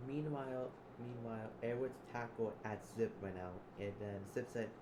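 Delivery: usual speaking voice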